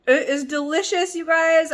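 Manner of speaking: high, loud burst of delight; thrilled with the taste; excited. Real, not acted. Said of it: "I'm so excited".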